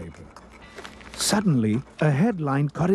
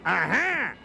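higher, restrained yell of discovery; Big Al yelling; 6 semitones higher than usual. A narrator's reading. Gang boss catching escapees, mild triumphant yell. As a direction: pounce on the catch, mildly yelled. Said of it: "yelled Big Al".

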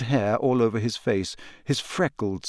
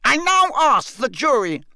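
high, louder shouted demand; Crouch shouting; 13 semitones higher, 4 dB louder than usual. A narrator's reading. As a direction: shout it to the jury over the screaming. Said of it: "shouted Mister Crouch".

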